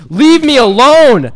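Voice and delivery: high, loud, drawn-out wail; exasperated yell. Real, not acted. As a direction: yell it slowly in real frustration. Under constant attack.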